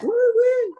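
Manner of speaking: high, whooping woohoo; hometown cheer. Real, not acted. Cheering her home county with a high whoop.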